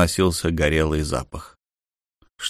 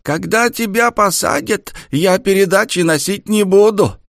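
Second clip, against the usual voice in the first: put-on loud croaking old woman; 12 semitones higher, 4 dB louder than usual. A narrator's reading. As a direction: croak it loudly like a cackling old grandmother. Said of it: "Громко прокряхтела бабушка".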